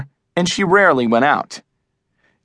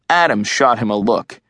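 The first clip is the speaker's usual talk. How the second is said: plain reading voice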